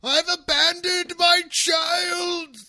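high, slow, drawn-out wail; anguished scream. Acted. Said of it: "You also yelled so loud".

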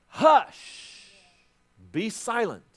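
loud, high "Hush", then a firm "Be silent"; Jesus rebuking the storm at full voice; booming command. Acted. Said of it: "he commands the storm".

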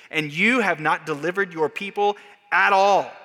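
loud, raw complaint flung at God; Moses' anguished protest. Retold or imitated. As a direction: cry out to the Master, accusing and desperate. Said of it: "He's just saying".

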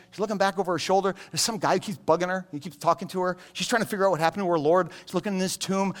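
normal talking voice

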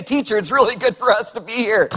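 high, loud gushing babble; excited nervous Peter. Retold or imitated. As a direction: gush like a nervous kid at camp. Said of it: "he just starts talking".

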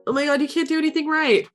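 high, rushed scolding; hammed-up impatient complaint; mock exasperation. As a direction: imitate crabby relatives, quick and high. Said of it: "they're all like".

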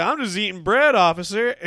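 put-on harmless-guy protest; acted innocent protest. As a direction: plead innocence to a cop, hammed up. His protest to the cop.

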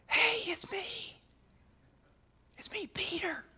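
high, hushed whisper-yell; Peter. Acted. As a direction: call out in a strained whisper, afraid to wake neighbors. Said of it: "whisper yelling".

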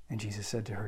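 usual speaking voice

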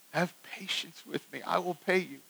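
soft, understated plea; pleading. Acted. Servant begging his creditor for time, softened plea. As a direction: plead softly, as if fallen at his feet. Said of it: "falls down and pleads".

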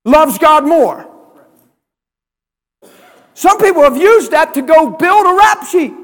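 loud, high, shouting sermon build; a preacher shouting his point home; full-force preaching. Acted. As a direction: preach it hard to the congregation.

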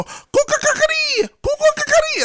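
very high squealed rooster call; uncle's rooster crow. Retold or imitated. Uncle imitating a rooster, shrill high crow. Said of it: "they go".

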